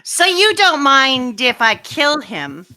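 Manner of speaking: slow, put-on villain voice; drawn-out, measured threat; Gunko. Villain threatening to kill hostage, cool menace. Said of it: "That's a good Gunko voice".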